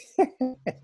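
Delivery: bright laugh; high amused laugh. Real, not acted. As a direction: laugh fondly. Laugh about the dog.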